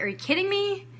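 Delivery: retold outrage, voice rising in disbelief; can't believe the nerve; angry disbelief. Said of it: "I was so pissed".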